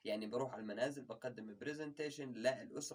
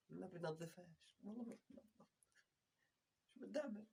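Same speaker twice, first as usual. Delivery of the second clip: muttered despair; 3 semitones higher, 11 dB quieter than usual. Real, not acted.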